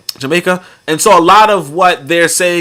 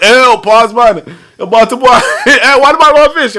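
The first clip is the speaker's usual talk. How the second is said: high, loud, laughing mock disgust; hammed-up scandalized protest, higher and louder; retold mock outrage; 8 semitones higher, 5 dB louder than usual. Retelling the friends' teasing about fish, mock outrage. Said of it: "they were like".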